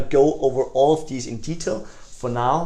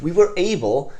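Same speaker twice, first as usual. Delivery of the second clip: enthusiastic; 5 semitones higher, 4 dB louder than usual. Shown as a demonstration. Salesman boasting results to prospect, higher and louder. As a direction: lift "were" with excitement. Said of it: "something that is wow".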